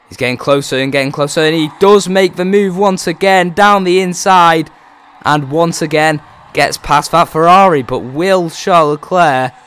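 loud, high excited race call; motorsport commentator. Acted. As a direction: build it up like a live commentator. Motorsport commentator building to overtakes.

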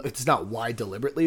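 normal talking voice

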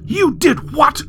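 high, slow, incredulous outrage; outraged. A narrator's reading. Mother hearing her son flushed the tie, outraged. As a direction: stretch it out in a high-pitched fury.

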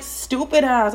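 normal talking voice

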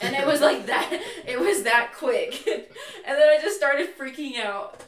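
laughing hard while telling it. Real, not acted. Sister laughing telling her brother she freaked out. Laughing at own panic story.

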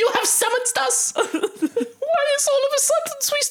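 high, grand little ant. Pretend. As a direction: speak as a solemn tiny ant. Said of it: "official ant voice".

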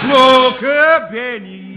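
slow, high and loud commercial chant; radio ad slogan, shouted. Retold or imitated. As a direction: belt it like a blaring radio jingle. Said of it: "Again and again".

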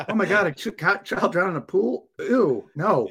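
disgusted mockery of a coward; mock squeamish. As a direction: act the squeamish quitter. Mocking squeamish responder.

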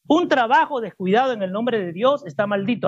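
scripture read in one continuous run; read straight through. Shown as a demonstration. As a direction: read it fluently without pausing. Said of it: "vuelvo a leerlo de corrido". (lectura de corrido, sin pausas)

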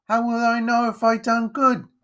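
put-on high, anxious boy's question; high-pitched urchin; worried young boy. A narrator's reading. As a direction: plead up at the master in a small high voice.

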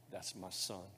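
hushed, gentle fatherly words; soft tender blessing. Acted. Pastor to the candidates, voicing God softly.

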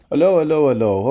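loud, high hello to check everyone's there; calling out. Real, not acted. Host returning from a pause, calling out loudly to listeners. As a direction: call out to the listeners.

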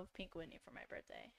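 excited party secret, whispered. Real, not acted.

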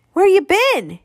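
high, loud bellowed question; angry yell. A narrator's reading. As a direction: yell it, furious and accusing. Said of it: "he c-, yelled".

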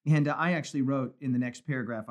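ordinary delivery

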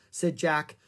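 ordinary delivery

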